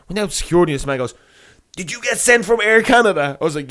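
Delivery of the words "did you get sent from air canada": high, loud accusing question; imitated irate guard. Security man confronts the stray passenger, retold irate challenge. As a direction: act out a guard demanding an answer. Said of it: "going fucking mental at me".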